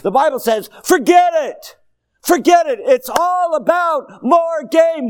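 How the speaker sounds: loud, high, impassioned pulpit shout; full-force preaching. Real, not acted. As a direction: drive each phrase home at the top of your voice.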